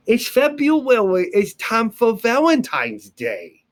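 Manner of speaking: put-on high, slow little-kid voice with February said as fɛbɹuwɛwi; teacher imitating her niece, high and slow. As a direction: play the small niece saying February her own cute way. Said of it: "My niece says February".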